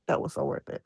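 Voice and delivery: breathy whispered repeat of "That was so worth it"; quiet whispered echo, "That was so worth it". Real, not acted. Repeated phrase, second whispered.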